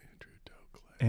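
breathy whispered echo. Real, not acted. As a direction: whisper the name back. Echoing the joke name.

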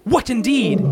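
normal talking voice

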